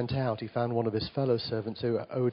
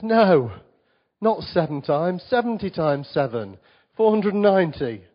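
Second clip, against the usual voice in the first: forceful Jesus quote; 4 semitones higher, 7 dB louder than usual. Retold or imitated.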